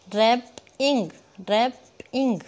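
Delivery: "rapping" broken as rap, ing; split syllables. Shown as a demonstration. Teacher divides the word for counting, "rapping" in two. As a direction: pause inside "rapping" after rap. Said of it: "जितने हम टुकड़ों में pronounce करेंगे".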